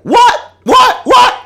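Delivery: very high, loud repeated yelps; dad's shocked outcry. Retold or imitated. Dad hearing on the phone that Kobe died, shocked outcry. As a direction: imitate a father blurting in utter shock. Dad's reaction to death news.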